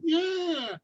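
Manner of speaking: crowd cheer. Retold or imitated.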